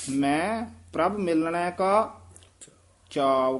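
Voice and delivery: ਕਾ lifted and held, then a pause before ਚਾਉ; halt on ਕਾ. Shown as a demonstration. Teacher shows the beat on ਕਾ, rise then pause. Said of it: "ਕਾ ਤੇ ਫੇਰ ਠਮਕ ਲਾ ਦੇਣੀ ਹੈ".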